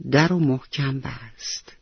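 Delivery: plain reading voice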